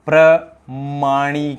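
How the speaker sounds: प्रमाणीकरण stretched very slowly, short pra, long nee; slow careful pronunciation. Shown as a demonstration. Teacher to students, drawing out the correct word slowly. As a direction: spell out प्रमाणीकरण slowly for the class. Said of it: "क्या होता है शुद्ध".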